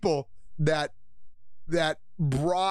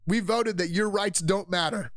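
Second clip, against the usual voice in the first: normal talking voice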